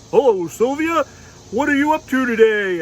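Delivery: dragon puppet voice. Pretend. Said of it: "he's like".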